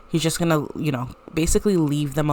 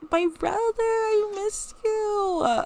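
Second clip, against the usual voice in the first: high, slow and tearful, like a sister near crying; teary sister voice; slower than usual. Acted. Greeting her brother home, high and tearful. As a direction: welcome him back with a high, teary voice. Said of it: "She's like".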